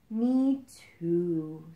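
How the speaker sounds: lightly voiced sighing toy elephant; a weary toy sighs it. A narrator's reading. Forgotten stuffed elephant agreeing with the frog, lightly voiced sigh. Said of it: "sighed a stuffed elephant".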